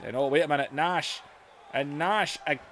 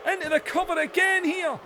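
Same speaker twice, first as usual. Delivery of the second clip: excited play-by-play; 9 semitones higher than usual. Acted. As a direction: yell the cover like it matters.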